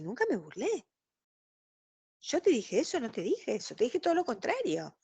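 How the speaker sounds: acting out an innocent-sounding denial; mock denial. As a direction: deny it all, voice lifted and soft. Manipulator's acted denial in example dialogue.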